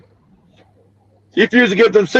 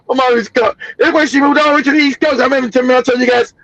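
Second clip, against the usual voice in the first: usual speaking voice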